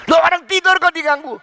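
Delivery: startled, scolding question; mock indignant protest. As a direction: imitate an affronted parent objecting. Acts out parent protesting the disturbance.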